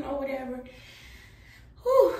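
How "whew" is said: winded high loud puff; out of breath. Real, not acted. Pregnant vlogger winded after brushing teeth, out of breath. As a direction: blow it out like the brushing wore you out. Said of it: "I be out of breath for nothing".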